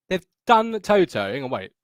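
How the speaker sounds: ordinary talk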